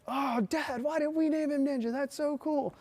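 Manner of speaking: put-on high, eager kid's voice; imitating an excited little nephew; excited child. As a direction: squeal it like a thrilled six-year-old.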